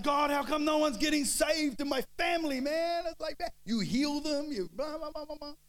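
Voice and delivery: very high, loud complaining wail; hammed-up whiny protest at God; whinging to God. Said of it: "bit of a whinge to God".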